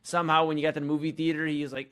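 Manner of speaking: ordinary talk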